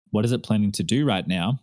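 ordinary talk, nothing marked

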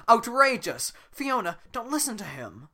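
outraged shout. Acted. Farquaad outraged.

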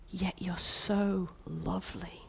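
soft tender king; tender reassurance. Acted. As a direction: soothe her gently. King voiced tenderly to bride.

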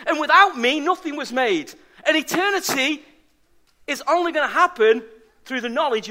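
a pastor proclaiming the resurrection to the congregation, fervently; fervent preaching. Acted. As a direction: preach it out, building with conviction. Preaching at full force.

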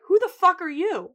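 mock indignant demand. Host as Fiona challenging a stranger, mock indignant. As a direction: demand it, voice jumping up. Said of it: "She's like".

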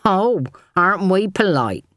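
prissy sneering mock-politeness; mock her manners in a simpering way; mincing mockery. A narrator's reading. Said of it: "he mocked in mincing tones".

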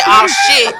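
high, loud mock "oh shit" through a laugh; laughing mock shout; mocking laughed quote. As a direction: throw his words back at him, laughing. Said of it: "Don't say".

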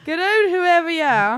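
put-on high, slow Dublin kid's threat; a little Dublin scamp yelling through the letterbox; Dublin accent. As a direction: drawl it like a cheeky Dublin young fella. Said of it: "In a Dublin accent".

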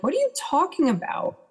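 high-pitched, baffled question; retold disbelief. Retelling a friend's phone call about Hof, baffled disbelief. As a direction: pitch it up, not buying the news. Retells first reaction to death news.

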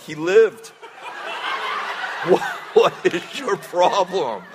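high self-mocking laughter; laughing at herself. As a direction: scold yourself while laughing. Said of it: "I'm like".

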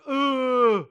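long, loud, high moaning wail; moaning mock sob. Dad demonstrating his crying to the congregation, loud moaning wail. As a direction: burst into an exaggerated full-throated sob. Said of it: "moan sobber".

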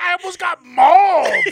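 high, loud outraged protest; hammed-up indignant holler; indignant mock shout. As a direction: yell it back in comic disbelief. Protesting to mom in retold quarrel.

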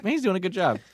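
excited inner thought. Retold or imitated. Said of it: "I felt like".

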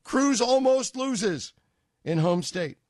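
mock-reading the papers' gleeful headline. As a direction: sneer out the headline. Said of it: "are going to write".

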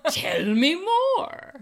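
long, drawn-out exaggerated interest; slow, high, loud playful relish; mock eagerness. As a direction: beg for gossip, stretching every word. Mock eager reply to friend.

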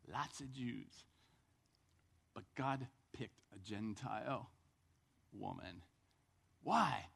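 usual speaking voice

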